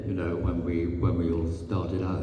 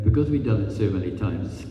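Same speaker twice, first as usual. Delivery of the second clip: normal talking voice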